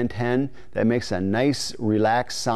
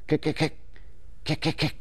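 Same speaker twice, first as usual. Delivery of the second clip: quiet, quick kick kick clucks; soft clucking kicks; 3 semitones higher, 6 dB quieter than usual. Shown as a demonstration. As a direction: breathe out little cacky kicks. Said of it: "You just want that little cack".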